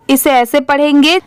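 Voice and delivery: usual speaking voice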